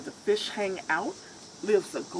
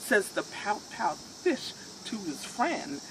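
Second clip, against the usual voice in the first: plain reading voice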